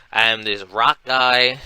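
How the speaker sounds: normal talking voice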